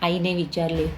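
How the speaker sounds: ordinary delivery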